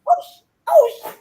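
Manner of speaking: very high, thrilled squeal; giddy mock scream; high, fast, excited squeal. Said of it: "She screamed".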